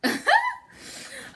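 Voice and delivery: high squealing laugh at herself; sudden amused laugh; burst of laughter. Real, not acted. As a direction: break into a real giggle. Laughs at her broken nails.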